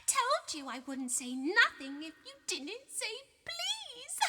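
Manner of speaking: high, slow, sing-song taunt; high mocking lilting chant; annoying sing-song. A narrator's reading. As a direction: drag it out like a nagging child. Peeves voiced by narrator.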